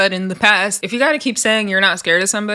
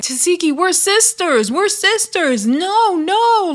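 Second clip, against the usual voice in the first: high-pitched mock pleading; pleading sister; 8 semitones higher, slower than usual. Imitating Krishan begging her sister, high-pitched mock plea. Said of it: "Krishan is like".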